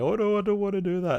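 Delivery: high-pitched imitation of nervous refusers; mock nervous. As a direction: whine it high, mocking their reluctance. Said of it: "they all get nervous".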